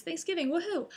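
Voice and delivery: a high, playful woohoo; mock cheer. Real, not acted. Vlogger joking about the holiday, high mock cheer.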